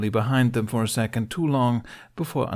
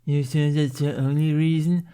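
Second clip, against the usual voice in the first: higher mocking echo of the taunt; parroting mockery; 7 semitones higher, 5 dB louder than usual. A narrator's reading. Snotlout mocking Jack's question, parroting echo. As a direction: mimic him back childishly. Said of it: "Snotlout parroted back".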